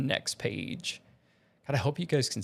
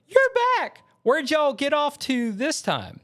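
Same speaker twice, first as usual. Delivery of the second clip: put-on very high, intense Southern accent; 13 semitones higher, 3 dB louder than usual. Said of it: "in the Southern accent".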